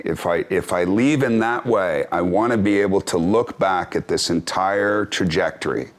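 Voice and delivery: normal talking voice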